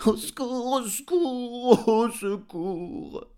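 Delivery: high, loud, drawn-out howl; dog crying for help. A narrator's reading. Dog calling under his master's window, howling. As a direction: wail for help in a dog's high voice.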